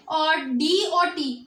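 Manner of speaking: letters stretched out slowly, one by one; slow spelled-out letters. Shown as a demonstration. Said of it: "अब ये दो words आ गए". (धीरे-धीरे अक्षर बोलना)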